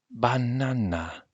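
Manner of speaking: slow, high, doubtful drawn-out Banana; dubious. Acted. Missus Owens doubting the banana, slow and dubious. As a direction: say Banana slowly, full of doubt.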